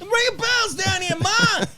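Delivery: high-pitched, hammed-up shout; mock shrieky call to Mom; son yelling to Ma. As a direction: holler up to your mother from downstairs. Plays son shouting to his mother.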